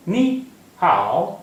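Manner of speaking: नी हाओ said slowly, each syllable apart with drawn-out tones; Mandarin model pronunciation. Shown as a demonstration. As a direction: teach नी हाओ slowly, हाओ dipping then rising. Teacher models Mandarin greeting slowly.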